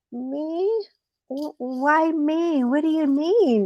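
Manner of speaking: high, soft, slow fake innocence; innocent rabbit. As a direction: play dumb, wide-eyed. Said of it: "And the rabbit goes".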